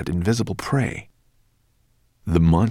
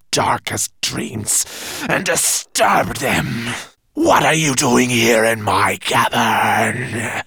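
monster voice; 2 semitones higher, slower than usual. Acted. Monster confronts the dragon.

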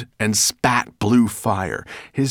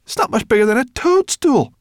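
high, scornful disbelief; horrified; 8 dB louder than usual. Acted. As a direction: sneer at the tiny boat.

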